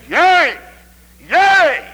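loud, high shouted YEA; exultant cry. Real, not acted. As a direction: cry it out over the congregation. Crying out the text's exclamation.

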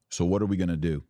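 usual speaking voice

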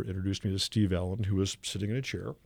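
ordinary talk, nothing marked

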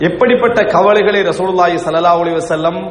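ordinary talk

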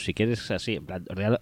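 normal talking voice

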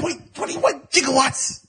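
high, slow excited movie quote; Doc Brown line. Retold or imitated. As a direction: squeak it out slowly like a frantic scientist.

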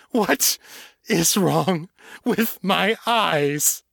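high, slow, forced through a closing throat; barely able to speak, breath ragged; choked desperate plea. A narrator's reading. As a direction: Tashana forcing out the words through tears. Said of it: "throat had nearly closed".

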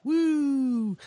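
high whoop of excitement; cheer. Real, not acted. Host whooping about being a fan. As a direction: let out a high, happy woo.

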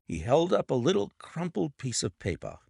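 ordinary delivery

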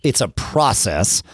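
slow down and lean on the word "process"; stress on process. Shown as a demonstration. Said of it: "I put emphasis on that word".

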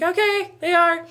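high, loud obedient squeak; high eager reply. Retold or imitated. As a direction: chirp it back eagerly. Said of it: "And I'm like".

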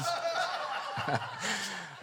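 high, airy giggle under his breath; breathless laugh. Real, not acted. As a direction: let a soft breathy giggle slip out. Laughs at own story.

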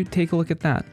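normal talking voice

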